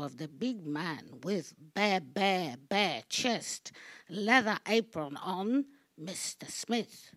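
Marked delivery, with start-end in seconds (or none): none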